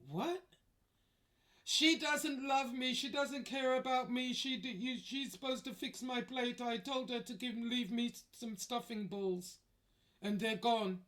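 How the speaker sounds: high, whiny imitation of a grown man sobbing; mock crying. Chef wails over missing stuffing balls, mock crying whine. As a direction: whine it like a hurt child.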